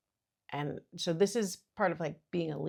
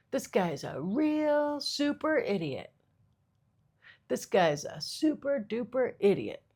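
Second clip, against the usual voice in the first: slow, higher laid-back surfer dude; 7 semitones higher, slower than usual. Shown as a demonstration. Comic keeps demoing "super" insults as a surfer. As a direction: stretch it out like a beach bum. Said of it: "a surfer voice".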